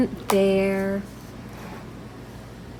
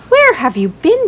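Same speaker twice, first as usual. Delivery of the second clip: high, louder happy question; excited; 6 semitones higher, 8 dB louder than usual. Acted. Class one happily asking the bear where he went. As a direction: greet the lost bear gladly.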